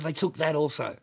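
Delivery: loud mock indignation; loud indignant quote. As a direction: punch it out like the angry mate. Repeats friend's line twice.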